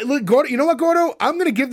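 calling out. Real, not acted. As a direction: call out to get his attention. Calling to Gordo.

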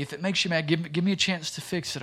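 normal talking voice

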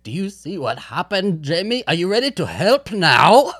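hammed-up Loomis pressing the girl; mock Loomis. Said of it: "he's basically like".

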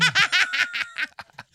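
high, loud burst of laughter. Real, not acted.

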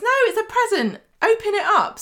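very high-pitched acted insistence; imitating an indignant denial; exasperated protest. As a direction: protest innocence, voice shooting up. Acting Lawrence protesting his innocence.

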